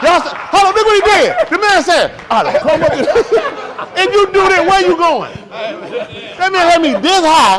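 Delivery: bursting to tell how the man got him; excited retelling. Real, not acted. Excited retelling to the crowd, loud and high.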